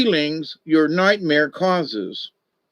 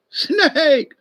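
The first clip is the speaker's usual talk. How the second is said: much higher, startled little lizard voice; startled lizard cry; 10 semitones higher than usual. Acted. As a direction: squeak it out like a frightened lizard. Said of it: "the Lizard cried".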